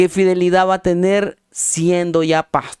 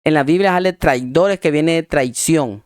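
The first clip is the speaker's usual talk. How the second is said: ordinary delivery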